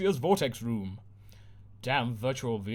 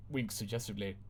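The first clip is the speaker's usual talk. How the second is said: ordinary delivery, nothing marked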